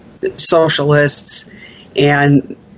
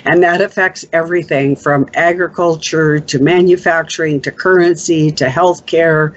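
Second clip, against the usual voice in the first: ordinary talk, nothing marked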